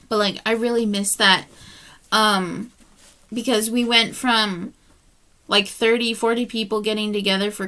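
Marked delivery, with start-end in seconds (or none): none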